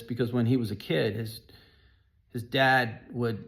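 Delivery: ordinary talk, nothing marked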